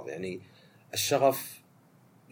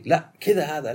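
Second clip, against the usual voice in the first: louder, impatient snap at a slow colleague; a diner losing patience, raised voice; impatient scolding; 6 semitones higher, 7 dB louder, slower than usual. Retold or imitated. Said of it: "يكلمه فارغ الصبر".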